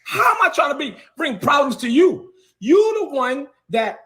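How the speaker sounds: furious, calling him out; real outrage at the archbishop; angry shout. Real, not acted. Heated rant at the bishop.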